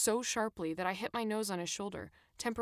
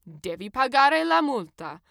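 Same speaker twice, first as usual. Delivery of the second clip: slow, loud Italian demand; barked order; 5 semitones higher, 10 dB louder, slower than usual. A narrator's reading. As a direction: order the passenger to pay, loudly. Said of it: "the man barked at me".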